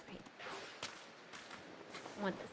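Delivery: normal talking voice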